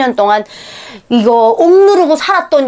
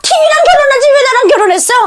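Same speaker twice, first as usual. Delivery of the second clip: mother's rant; 6 semitones higher than usual. Retold or imitated. Mother griping about the father and his TV, fast rant up to a laughing shout. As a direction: build the nagging up to a laughing yell. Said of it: "그릇에다가 다 화풀이를 하지".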